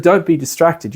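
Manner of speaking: ordinary talk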